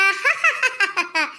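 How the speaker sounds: a short real laugh at her own joke. Real, not acted. Woman laughing at her own jab at the mother-in-law. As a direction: burst out laughing at the teasing.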